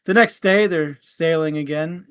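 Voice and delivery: ordinary delivery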